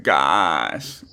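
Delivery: low, creaky vocal-fry drawl. Retold or imitated. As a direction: imitate girls talking in vocal fry. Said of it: "vocal fry thing where it's like".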